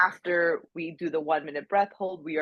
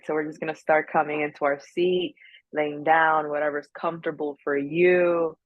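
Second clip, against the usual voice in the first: unhurried breath-work guide, easing everyone in; guided breath work. Acted. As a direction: lead them gently into the breath.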